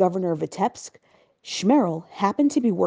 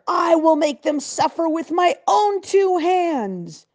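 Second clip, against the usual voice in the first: slow, high-pitched furious threat; booming threat; 9 semitones higher, 3 dB louder, slower than usual. A narrator's reading. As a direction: spit out the vow of revenge slowly. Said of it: "boomed the governor".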